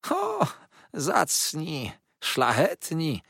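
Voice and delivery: sneering high mock praise. A narrator's reading. Uriah sneers at his accusers with mock praise. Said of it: "śmiał się z cicha Uriah".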